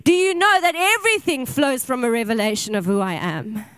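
loud, ringing sermon proclamation; preaching at full force. Acted. Pastor voicing Jesus' promise to the church, loud preaching. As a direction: proclaim it like thunder from the pulpit.

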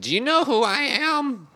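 high, slow, haughty diva voice; snooty diva. Retold or imitated. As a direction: play the entitled star. Imitating diva quote.

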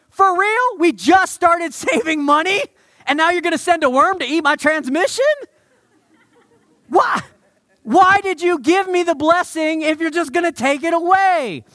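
loud, high, indignant protest to God; exasperated complaint. Retold or imitated. Believer venting at God over broken transmission, loud and indignant. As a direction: whine at God like he cheated you. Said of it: "And you're like".

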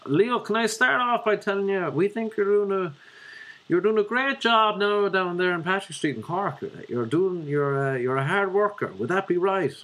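high, put-on Dublin manager voice; Dublin accent. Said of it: "I could do a Dublin one".

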